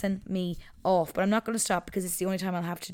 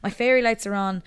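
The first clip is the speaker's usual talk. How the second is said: ordinary talk, nothing marked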